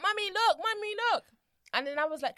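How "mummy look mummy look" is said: put-on high, eager little girl calling mum; lightly mimicked daughter showing off in glasses; excited toddler. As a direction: imitate a small child tugging for attention. Mother voices excited toddler.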